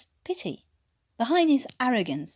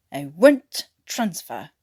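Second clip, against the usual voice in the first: muttered through teeth; 4 semitones lower, 6 dB louder, slower than usual. Acted. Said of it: "Watkins muttered between clenched teeth".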